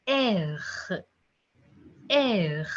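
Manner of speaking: "R" as "air" ending in a throaty gargle; gargled French R. Shown as a demonstration. Teacher shows the French letter R, gargled. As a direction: let the French "R" rasp at the back of the throat. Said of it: "A gargling sound is there".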